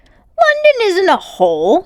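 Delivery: high, slow, indignant retort; a girl offended for her city. A narrator's reading. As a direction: bristle at the insult. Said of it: "said Polly indigently".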